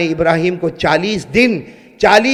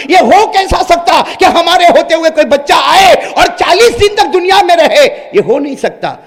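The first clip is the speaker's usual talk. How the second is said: boastful shout, higher and louder; proud boast; 7 semitones higher, 6 dB louder than usual. Acted. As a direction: brag to the king. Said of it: "بڑے فخر کے ساتھ کہا". (فخر سے بلند دعویٰ)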